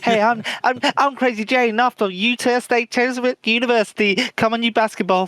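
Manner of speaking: high-pitched mocking college girl; put-on high, silly introduction voice; parody of college girls. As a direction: send up a college athlete's video intro. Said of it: "that guy takes a piss out of him".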